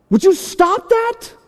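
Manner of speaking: high, drawn-out scolding; acted high-pitched outburst at wife; exasperated yell. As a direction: snap at a passenger in exasperation. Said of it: "yelled at her".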